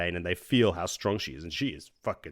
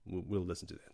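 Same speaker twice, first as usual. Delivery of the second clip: much softer, lower sheepish reply; 4 semitones lower, 14 dB quieter, faster than usual. Retold or imitated. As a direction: back down quietly, a bit cowed. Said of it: "So Elaine's like".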